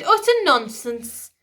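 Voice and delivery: high, loud, slow indignant queen; insist, offended; queen protesting. A narrator's reading. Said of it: "she protested".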